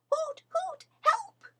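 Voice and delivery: high owl hoots crying for help; a tiny stuck owlet calling out; high squeaky hooting cry; squeaky little voice. A narrator's reading. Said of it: "came a squeaky little voice".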